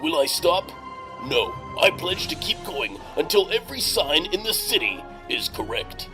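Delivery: ordinary talk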